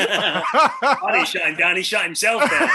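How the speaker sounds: laughing. Real, not acted. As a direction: deny it while cracking up. Laughing off accusation.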